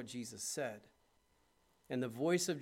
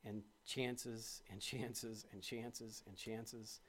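soft, slow, fading away; fading repetition; 3 semitones lower, 9 dB quieter, much slower than usual. Acted. Pastor marveling at endless second chances, softly fading. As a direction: let each repeat fall quieter and slower.

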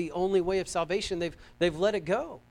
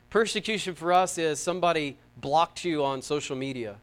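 normal talking voice

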